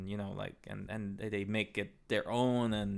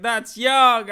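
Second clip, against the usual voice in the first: imitating lady; 11 semitones higher, 12 dB louder than usual. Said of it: "She's like".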